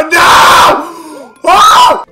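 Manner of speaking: normal talking voice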